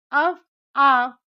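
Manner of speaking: अव and आव drawn out slowly, one by one; slow sound drill. Shown as a demonstration. Teacher to students, drilling the syllables slowly. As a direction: stretch अव, आव for the class. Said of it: "शब्द को हम उच्चारित करेंगे".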